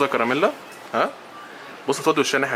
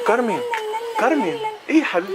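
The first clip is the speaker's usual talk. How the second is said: high cooing. Real, not acted. Soothing little Carmen when she cries, very high coo. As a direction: lift the voice way up and coo her name.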